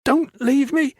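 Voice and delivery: high, frightened plea; terrified plea. A narrator's reading. Trapped girl begging Valin, terrified plea. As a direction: beg him not to go. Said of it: "her dark eyes terrified".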